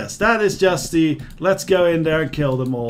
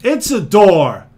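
loud, slow and emphatic; exasperated retort; 7 dB louder, slower than usual. Acted. Gar answers the companion pleading for a door, loud exasperation. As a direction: spell it out loudly for someone dim.

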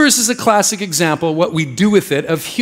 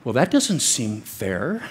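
doubting skeptic; 6 semitones lower, 7 dB quieter, slower than usual. Retold or imitated. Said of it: "Or we could look at this and be like".

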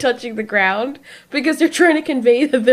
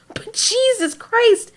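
higher, slow, drawn-out "Jesus Christ"; exasperated exclamation; 10 semitones higher, much slower than usual. Real, not acted. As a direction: let the exasperation stretch out. Exasperated at comic characters' logic.

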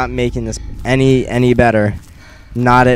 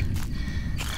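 breathless whispered urging, no voice; tense whisper; 15 dB quieter, slower than usual. Real, not acted. Gamer hiding from Slender, whispering to herself tensely. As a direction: hiss it so the monster won't hear. Tense moment near the monster.

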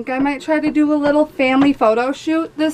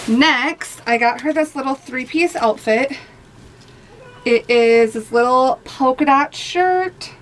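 ordinary talk

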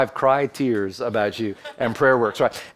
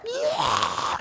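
mock child scream; 4 dB quieter than usual. Daughter screaming in pain, retold.

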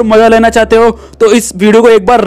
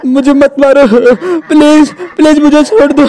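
higher, tearful pleas for mercy; terrified begging; 4 semitones higher than usual. Acted. Boy pleading with the ghost not to kill him, crying and terrified. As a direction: beg for your life, voice breaking.

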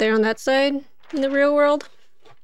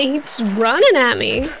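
usual speaking voice

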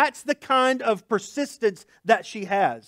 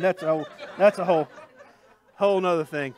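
laughing aside; 4 semitones lower, faster than usual. Real, not acted.

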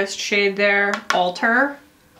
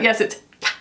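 usual speaking voice

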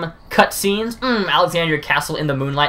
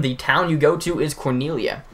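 ordinary talk, nothing marked

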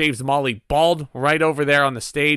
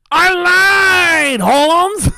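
loud, high-pitched mock wrestler yell; imitated taunting shout; 7 dB louder, much slower than usual. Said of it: "he's like".

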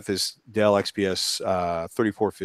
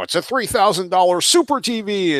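mocking buyer voice; 11 semitones higher, 5 dB louder than usual. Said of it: "you may think, like, you know".

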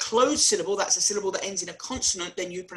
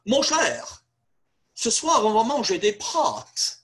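suave, higher French advert voice; posh French ad voiceover; 4 semitones higher, 3 dB louder than usual. Retold or imitated. Ad voiceover tempting viewers with posh pasta. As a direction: purr it like a posh TV ad. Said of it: "the voiceover said".

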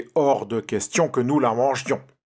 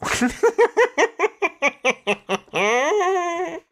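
high, long witch cackle; shrill triumphant witch cackle; evil cackle; 4 dB louder than usual. Acted.

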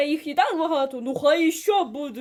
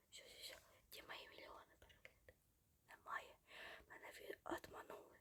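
barely audible, hurried whisper; mock grievance in a tiny whisper; whispered lament; 32 dB quieter, faster than usual. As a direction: whisper the complaint under your breath. Mock lament over missing views.

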